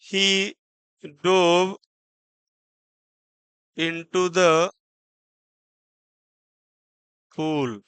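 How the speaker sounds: slow word-by-word reading, long pauses; measured classroom dictation. Shown as a demonstration. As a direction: read slowly for copying. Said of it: "तो इसको क्या read किया गया है".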